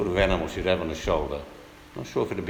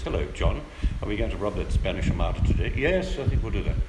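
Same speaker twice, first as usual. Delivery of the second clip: usual speaking voice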